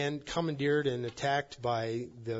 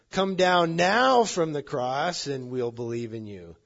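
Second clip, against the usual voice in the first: slow, loud, raised challenge; loud mocking demand; 4 semitones higher, 10 dB louder than usual. Priests demanding Jesus come down, loud and drawn out. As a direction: call up to the cross, leaning hard on NOW.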